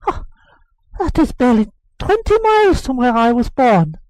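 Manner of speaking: put-on high, loud eager boy's voice; boy telling his nurse about the king; excited boy. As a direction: blurt out the news like an excited child. Boy eagerly reporting the troop.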